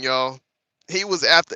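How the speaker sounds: ordinary delivery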